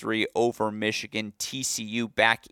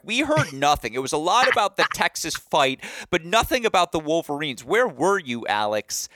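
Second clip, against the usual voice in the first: mock yelling relatives; 6 semitones higher, 3 dB louder than usual. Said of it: "They were like".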